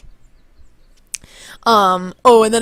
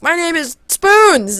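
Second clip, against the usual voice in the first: high, loud, slowed declaration; mock proud announcement; 9 semitones higher, 5 dB louder, slower than usual. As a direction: imitate a hero announcing himself grandly. Deadpool announces a false name.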